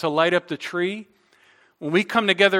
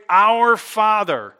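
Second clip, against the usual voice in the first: "Our" drawn out, slow and louder; slow, stressed Our Father; 4 semitones higher, 5 dB louder, much slower than usual. Shown as a demonstration. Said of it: "Jesus says, when you pray".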